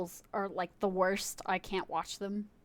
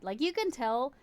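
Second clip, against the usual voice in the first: normal talking voice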